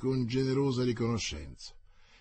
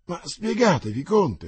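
high, loud anguished cry; anguished exclamation; 7 semitones higher, 8 dB louder than usual. Acted. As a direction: plead in rising anguish. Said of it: "esclamò la regina in angoscia". (grido angosciato, più alto e forte)

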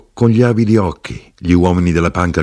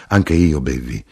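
usual speaking voice

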